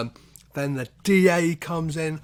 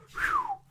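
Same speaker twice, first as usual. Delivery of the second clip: mock relief whew. Relief that Jackson survives.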